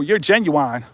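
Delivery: ordinary talk, nothing marked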